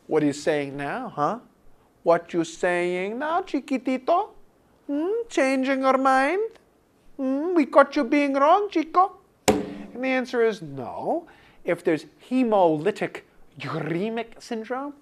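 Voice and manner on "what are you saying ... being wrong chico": mocking singsong, pitched higher; playful higher taunting of Conrad; teasing taunt. Real, not acted. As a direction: needle Conrad for changing his mind. Teasing student named Conrad.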